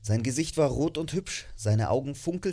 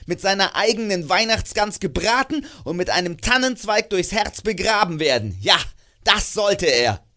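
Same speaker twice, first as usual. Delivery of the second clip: loud, bitter rant of a miser, raised pitch; grumpy old miser; 6 semitones higher, 7 dB louder than usual. Acted. Scrooge berates his cheerful nephew, loud and bitter. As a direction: spit out every word against Christmas. Scrooge rants against Christmas.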